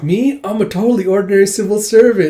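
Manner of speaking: high, loud protest of innocence; mock-innocent protest. Character protests being ordinary.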